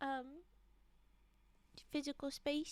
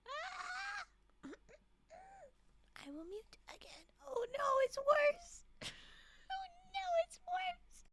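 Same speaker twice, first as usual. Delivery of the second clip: mock wailing; 13 semitones higher than usual. Real, not acted. Coughing fit gets worse.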